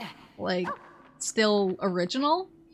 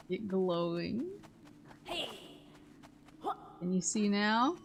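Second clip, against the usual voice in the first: soft, sly lizard voice; sly sticky chameleon; 12 dB quieter than usual. Acted. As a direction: creep in as a sneaky lizard. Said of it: "the strange lizard voice".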